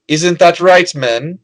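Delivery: queen calling out to her training soldiers, loud and rousing; rallying call to troops. Acted. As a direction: call out to your men, proud and rousing. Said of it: "turns to the soldiers who are practicing and goes".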